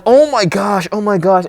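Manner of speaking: high, loud panicked gasping oh my gosh; reliving a childhood scare out loud; excited kid panic. Retold or imitated. Recalling the train scene as a kid, high loud excited panic. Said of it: "I'm like".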